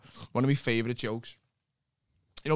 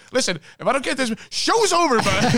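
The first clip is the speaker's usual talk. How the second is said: high, loud, pleading rocker; desperate rock star voice; 12 semitones higher, 6 dB louder than usual. Retold or imitated. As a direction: beg the crowd for the pick back. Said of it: "and he goes".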